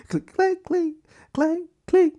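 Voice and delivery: very high, tinny fork noises; imitated cutlery clinking. Husband keeps eating, high-pitched clinks. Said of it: "still eating my food".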